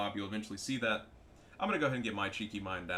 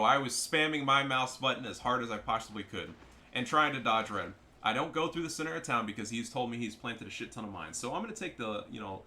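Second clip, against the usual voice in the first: normal talking voice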